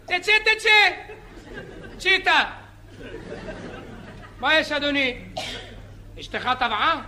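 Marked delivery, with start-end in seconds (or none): 0.0-2.4 high shouted order to leave the water; lifeguard yelling. Acted. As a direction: bark it across the beach. (צעקת מציל)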